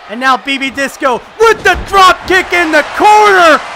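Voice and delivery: ordinary talk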